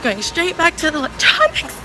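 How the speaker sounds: loud, breathless talk on the run; running, loud. Real, not acted. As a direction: talk while running flat out. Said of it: "I'm getting out of breath".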